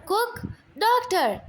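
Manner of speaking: sharp high call for the doctor. Acted. Mother calling out for the doctor in panic, loud. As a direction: shout for help, high and loud.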